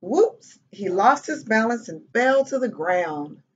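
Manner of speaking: animated read-aloud with bouncy pitch; children's story narration. A narrator's reading. Reading a picture book to kids, lively storytelling. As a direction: tell it to little listeners, pausing before each mishap.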